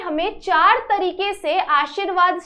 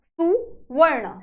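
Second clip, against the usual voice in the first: सुवर्ण drawn out very slowly, every sound clear; much slower than usual. Shown as a demonstration. Teacher sounding out a word for pupils, very slow. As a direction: stretch सुवर्ण so they hear su-var-na. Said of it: "खुद से बोल के try करो".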